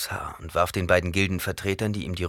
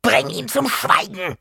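hoher, langsamer Altfrauenschrei; kreischender Befehl; 8 dB louder than usual. A narrator's reading. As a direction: verzweifelt befehlend. Alte Frau schreit weiter. (alte Frau schreit Jessica an)

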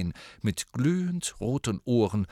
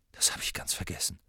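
hastig, tonlos geflüstert; verblüfftes Flüstern; 3 semitones lower, 8 dB quieter, faster than usual. A narrator's reading. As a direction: fassungslos vor sich hin hauchen. Harry verblüfft. (verblüfft flüsternd)

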